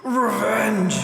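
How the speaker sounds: anguished shout; scream. Acted. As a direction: scream it out of hatred. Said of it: "you scream".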